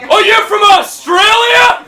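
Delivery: slow, high American shout; loud, hammed-up American disbelief; mock American accent. American doubting comic is Australian, shouted mock American accent. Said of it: "An American came up, he was like".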